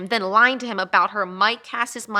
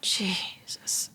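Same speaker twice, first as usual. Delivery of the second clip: softer, breathless whispered disbelief; exasperated whisper; 10 dB quieter, slower than usual. Real, not acted. Detective reacts to partner's reckless plan in a disbelieving whisper. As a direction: mutter it in disbelief under your breath.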